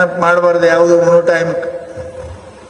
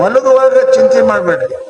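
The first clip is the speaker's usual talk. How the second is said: higher, raised-voice exhortation; emphatic proclamation; 5 semitones higher than usual. Real, not acted. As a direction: proclaim the rule to the congregation, pitch lifted. Second rule proclaimed, then repeated softly.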